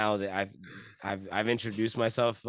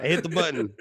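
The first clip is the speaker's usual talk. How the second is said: laughing; 6 semitones higher, 4 dB louder than usual. Real, not acted. Laughing at own teeth-brushing mishap.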